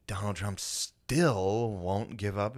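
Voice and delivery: snippy radio host with attitude, dragging the words out; imitated snippy news lady; snippy newsreader. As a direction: draw it out slowly with pointed attitude. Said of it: "Little fucking snippy out of the N P R lady".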